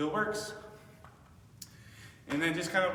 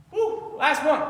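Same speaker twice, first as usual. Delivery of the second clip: excited cheer; 8 semitones higher, 8 dB louder, slower than usual. Real, not acted. Coach cheering on the last rep.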